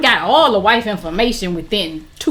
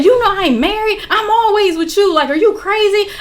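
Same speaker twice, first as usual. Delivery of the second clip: high-pitched imitation of a lying man; indignant denial; 8 semitones higher than usual. Boyfriend swearing to her he isn't married, mock indignant. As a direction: mimic his protest, pitched well up. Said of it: "He's swearing he's not married".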